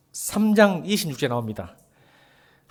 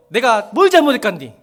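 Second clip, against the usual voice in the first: louder protest to God, stress on 뭘; indignant protest; 5 semitones higher, 5 dB louder than usual. Retold or imitated. Worshipper protesting to God, loud and indignant. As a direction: complain to God about 뭘 잘못했간디, raised. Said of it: "소리 지르시라니까요".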